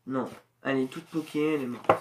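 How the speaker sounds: ordinary talk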